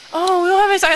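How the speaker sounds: lightly voiced high store clerk; apologetic little excuse; meek clerk.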